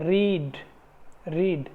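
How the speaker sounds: रीड with a long drawn ee; long ee demo. Shown as a demonstration. Teacher models रीड for students, long ee held. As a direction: say रीड stretching the ee. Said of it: "long ई sound है".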